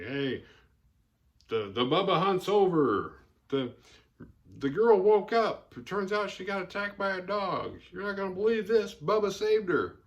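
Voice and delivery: a hint of a sheriff blurting it over the CB; sheriff on the radio. Sheriff calls the hunters on the CB, excited.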